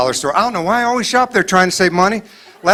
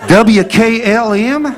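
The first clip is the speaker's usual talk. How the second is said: very slow, higher and questioning; unsure chart reading; 6 semitones higher, 3 dB louder, much slower than usual. Pretend. Patient guessing the chart letters, slow and unsure. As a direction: squint out "W K L M" slowly, each letter higher and doubtful.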